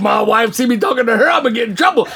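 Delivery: high, worked-up pleading; mock-alarmed excuse, pitched right up; comic protest. Husband pleads with God to be excused, comic protest.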